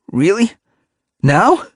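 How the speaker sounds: high startled disbelief; surprised. Acted. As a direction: blurt it out, high and loud. Splashtail's excited disbelief.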